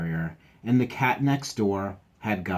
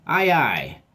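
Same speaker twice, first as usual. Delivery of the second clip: higher, louder cheerful aye aye; pirate aye aye; 5 semitones higher, 6 dB louder than usual. Pretend. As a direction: salute like a jolly deckhand. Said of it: "teacher answers in pirate mode".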